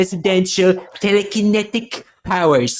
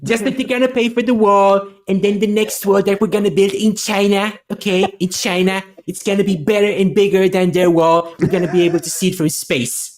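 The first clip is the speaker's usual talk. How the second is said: Trump impression. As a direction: brag like Trump at a rally. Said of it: "And now Trump's gonna climb in Santa's sleigh".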